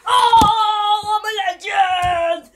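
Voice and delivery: loud, high victory yell; excited shout. Real, not acted. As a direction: celebrate the no scope. Celebrating a kill.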